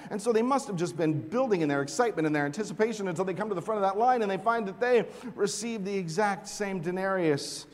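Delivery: usual speaking voice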